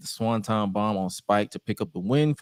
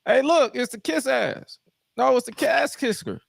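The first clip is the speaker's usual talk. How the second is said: mocking taunt; 13 semitones higher than usual. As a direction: tease him in a silly high voice.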